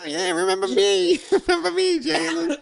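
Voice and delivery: high, loud leprechaun squeak; squeaky little leprechaun pleading to be noticed. Pretend. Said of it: "leprechaun voice when we do".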